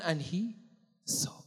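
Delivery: fading to a whisper on saw; hushed reveal. Acted.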